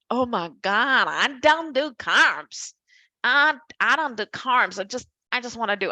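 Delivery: put-on Valley girl accent. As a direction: play a Valley girl who swears off carbs. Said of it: "this is my Valley girl accent coming out".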